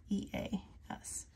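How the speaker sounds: unvoiced whisper spelling out letters; quiet spelling whisper. Real, not acted. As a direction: whisper it to yourself.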